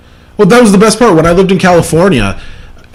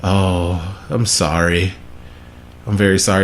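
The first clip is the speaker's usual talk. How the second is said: low, hushed mock sympathy; soft, pitying apology, retold; sympathetic condolence; 8 semitones lower, 9 dB quieter than usual. Californians pitying him over his representative, hushed sympathy.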